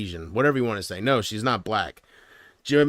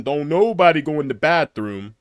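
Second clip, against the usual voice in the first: John Witherspoon impression; 4 dB louder than usual. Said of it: "hear John Witherspoon going".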